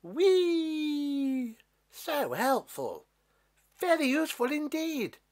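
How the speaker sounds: high, joyful giant voice; thrilled and grateful; a happy giant thanking the beanstalk; delighted giant. Acted.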